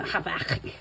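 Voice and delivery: Habakkuk as hah-BAHK, with a throaty Hebrew touch; Hebrew-style pronunciation. Shown as a demonstration. Vlogger adding a little Hebrew to the name, throaty pronunciation. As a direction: put a little Hebrew sound into Habakkuk, stressing the middle. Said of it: "put the little Hebrew".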